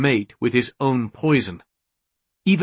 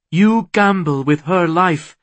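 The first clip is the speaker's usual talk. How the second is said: raised, louder accusation; accusing; 6 semitones higher, 5 dB louder than usual. A narrator's reading. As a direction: challenge him, higher and louder. Accusing him in a quarrel.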